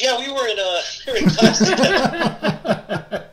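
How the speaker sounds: slow, high words breaking into laughter; can't hold back the laugh at the reveal; giggling while telling the punchline; laughing through it. Real, not acted.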